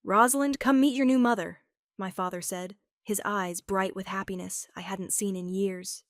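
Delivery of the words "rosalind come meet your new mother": joyful call. A narrator's reading. Father joyfully calling daughter.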